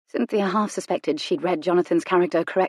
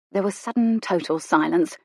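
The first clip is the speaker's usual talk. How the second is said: usual speaking voice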